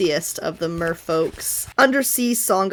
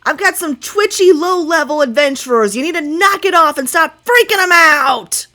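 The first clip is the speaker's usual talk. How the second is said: loud, higher, scolding yell over the water; annoyed shout; 7 semitones higher, 7 dB louder than usual. Acted. Sativa yells down at the merfolk from the boat, annoyed. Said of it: "She's like".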